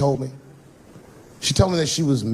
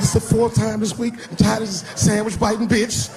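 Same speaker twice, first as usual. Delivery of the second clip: put-on higher sing-song boy voice; whiny kid; 9 semitones higher than usual. Son ranting about sandwich thief, sing-song whine. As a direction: complain like a fed-up kid. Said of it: "He said".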